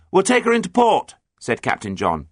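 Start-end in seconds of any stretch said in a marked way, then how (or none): 0.1-1.1 high, loud declaration; calling up. A narrator's reading. Captain tells the pirates his plan, calling up. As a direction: call it up firmly to the lookout.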